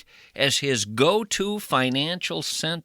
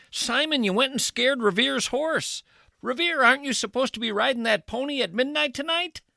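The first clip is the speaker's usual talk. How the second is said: much higher, scolding lumberjack; high Bunyan voice; 10 semitones higher than usual. Acted. Bunyan scolding Simon for scaring Revere's horse, high voice. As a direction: pitch way up to chide Simon. Comic voicing of Paul Bunyan.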